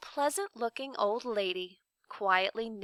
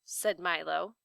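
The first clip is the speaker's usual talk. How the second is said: ordinary delivery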